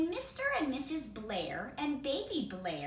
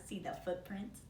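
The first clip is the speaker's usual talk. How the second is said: ordinary delivery, nothing marked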